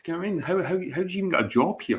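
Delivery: high, disbelieving rant; incredulous. Retold or imitated. Ranting to viewers about a lazy coworker, incredulous. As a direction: pitch up in outraged disbelief.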